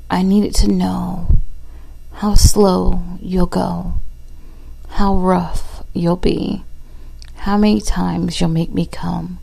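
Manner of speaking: loud, intimate spoken-word reading; poem recital. Acted.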